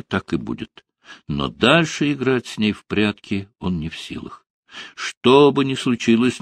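ordinary delivery

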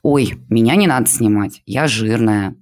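plain reading voice

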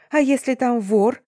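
high, alarmed friend on the phone; urgent, high-pitched outcry; yelling. A narrator's reading. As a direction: raise your voice in worried protest. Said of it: "завопила трубка".

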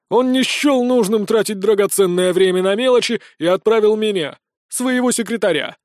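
loud, haughty elf secretary; lofty, self-important announcement; hammy stage actor. Acted. Pompous elf secretary continues.

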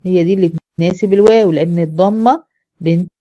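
normal talking voice

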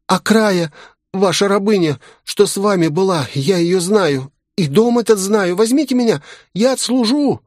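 put-on very high-pitched voice of a crying boy; tearful pleading. A narrator's reading. Street boy begging a lady to take him into service, tearful. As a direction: plead desperately in a high, whining child's voice.

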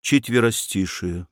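plain reading voice

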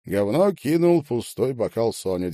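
usual speaking voice

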